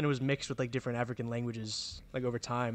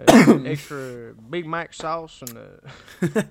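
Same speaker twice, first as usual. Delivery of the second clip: Southern accent impression; 11 dB louder, slower than usual. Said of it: "like a gut to it".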